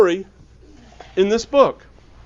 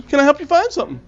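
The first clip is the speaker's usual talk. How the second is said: cheerful clerk; 7 semitones higher, 4 dB quieter, much faster than usual. Retold or imitated. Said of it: "always cheerful and asks".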